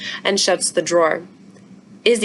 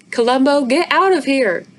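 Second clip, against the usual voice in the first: yelling at the dog; 6 semitones higher, 4 dB louder than usual. Acted. Mother chasing the dog.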